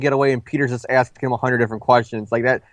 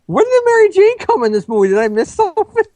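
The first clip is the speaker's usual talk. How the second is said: mock confused moviegoer, much higher; baffled viewer; 4 dB louder than usual. Host mocking a confused viewer, much higher. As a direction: squeak it out like a lost fan in the theater. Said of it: "And it's like".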